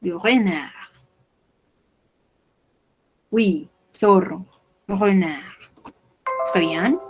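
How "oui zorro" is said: ordinary delivery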